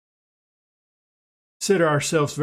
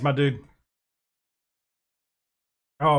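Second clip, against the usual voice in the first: ordinary delivery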